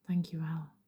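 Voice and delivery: breathy thank-you whisper; soft whisper. Shown as a demonstration. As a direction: whisper it gently to yourself. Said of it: "fluister je zacht tegen jezelf".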